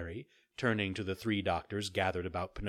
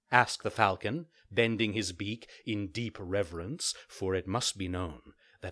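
usual speaking voice

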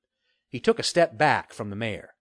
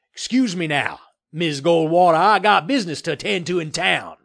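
loud, higher-pitched evasive bluster; blustering excuse; 5 semitones higher, 6 dB louder than usual. A narrator's reading. Developer escaping the reporter's questions, blustering excuse. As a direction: bluster your way out of it. Murtaugh hurries away from reporter.

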